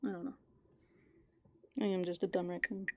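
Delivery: normal talking voice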